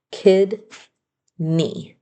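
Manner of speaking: put-on Kidney split slowly, KID, pause, NEE; syllable by syllable. Shown as a demonstration. Teacher introducing a spelling word, "Kidney" syllable by syllable. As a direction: leave a clear gap inside "Kidney".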